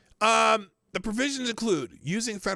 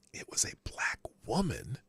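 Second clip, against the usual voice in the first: hushed, secretive half-whisper; stage whisper; 5 semitones lower, 6 dB quieter than usual. Real, not acted. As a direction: whisper it as a conspiratorial aside. Confiding aside about the race issue.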